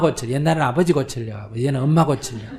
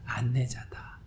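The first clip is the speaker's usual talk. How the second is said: whisper; 7 dB quieter, slower than usual. Shown as a demonstration. Monk repeats the key word to the audience in a whisper. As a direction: whisper the word 안내자다 softly with no voice.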